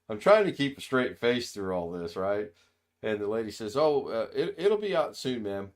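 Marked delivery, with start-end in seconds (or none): 3.8-5.7 placating waitress. Retold or imitated. Waitress placating upset customer.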